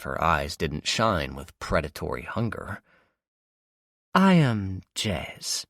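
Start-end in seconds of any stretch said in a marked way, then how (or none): 4.1-5.6 slow, drawn-out introduction in a put-on voice; villainess. Jez introducing herself to captive, slow villainess. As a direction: savour each word of her name.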